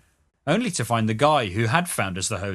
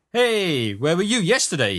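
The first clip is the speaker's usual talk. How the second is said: high-pitched hustler calling out; pushy bumpster; 6 semitones higher than usual. A narrator's reading. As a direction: hail a passer-by high and insistent. Pushy bumpster hails the narrator.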